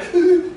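high, showy fake sobbing; hammed-up whimpering wail; mock crying. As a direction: put on a loud cry for an audience. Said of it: "so they can see you crying".

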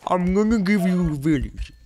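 high put-on voice as the game; game's voice. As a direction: speak as the game itself. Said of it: "you're making this voice".

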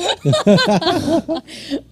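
loud burst of laughter; real laugh, out loud; hearty laughter. Real, not acted. Laughing at friend's reconciliation text story, loud burst.

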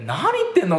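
high-pitched bewildered question; baffled reaction. Retold or imitated. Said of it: "と思ったらね". (訳が分からず高い声で)

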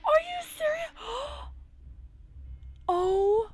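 high-pitched panicked shrieks between gasps; horrified disbelief, voice cracking; real panicked gasps. Real, not acted. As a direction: gasp and cry out in real shock. Sim character suddenly dying on screen.

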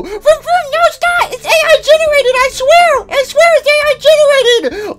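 very high squeaky toy voice, protesting; Mister Hugs squeaking that the photo is fake; squeaky denying voice. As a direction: squeal a panicked denial.

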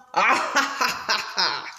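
mischievous little cackle; wicked laugh. Acted. Odile enjoying scaring passers-by, mischievous cackle. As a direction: laugh like a naughty crocodile child.

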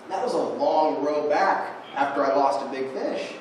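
ordinary talk

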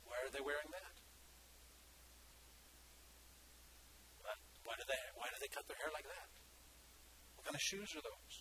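soft, quick fault-finding under the breath; hushed critical grumble; muttered criticism. Acted. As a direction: mutter disapproving questions to yourself.

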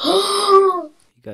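high, loud and slow teasing; excited teasing. Real, not acted. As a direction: drag it out high. Teasing a sick friend.

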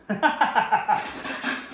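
breathy laugh. Real, not acted. Laughs at own maths.

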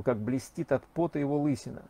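ordinary delivery, nothing marked

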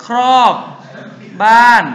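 high, loud word-by-word recitation; punchy high-pitched classroom drill. Shown as a demonstration. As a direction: call out each memory word loudly, held long. Drilling a memory phrase aloud.

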